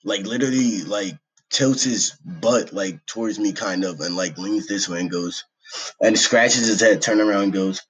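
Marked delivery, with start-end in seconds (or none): none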